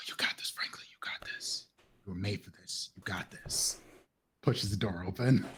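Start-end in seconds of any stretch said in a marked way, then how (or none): 0.1-3.8 soft whispered self-encouragement; whispered pep talk. Acted. Franklin psyching himself up before opening the door, whispering. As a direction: whisper to yourself to stay brave. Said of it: "psyching himself up, whispered".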